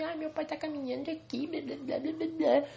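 high, whiny mock of the ex-girlfriend; mocking whiny girl. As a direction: sneer it in a put-on whiny voice. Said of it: "ela fala isso pra mim".

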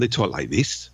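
attempted Geordie accent on "they talk like this"; Newcastle accent. Shown as a demonstration. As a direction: lightly imitate a Newcastle speaker. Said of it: "Newcastle is".

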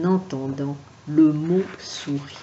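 usual speaking voice

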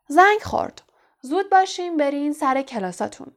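high, loud announcement to a crowd; calling out. A narrator's reading. Teacher calls out to the crowd. (با صدای بلند رو به جمعیت)

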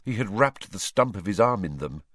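usual speaking voice

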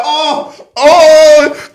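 loud, high mock-shocked "oh"; acted amazed reaction. Watching the slap from under a blanket, acted amazement.

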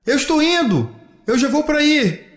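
high answering call; child's high-pitched holler; calling back. Acted. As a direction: shout that you are on your way. Child calls back to brother.

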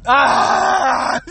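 loud strained cry of desperation; pained cry. Real, not acted. Driver desperate to pee, loud pained cry. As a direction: let out a pained wail.

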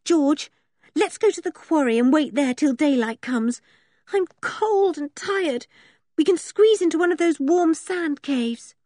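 put-on high, plaintive girl's voice; cold, tired and fretful; anxious pleading. A narrator's reading. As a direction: plead anxiously, pitched well up. Frightened Anne pleads with George.

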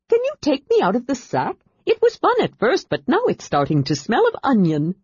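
high complaining little voice; a trapped creature grumbling; muffled Kender whine. A narrator's reading. Kender voice in bag.

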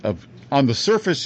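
ordinary talk, nothing marked

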